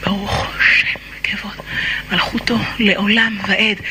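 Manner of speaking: low, hushed whisper; whispered prayer. Acted. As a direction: breathe the words almost silently. Customary whispered Shema line.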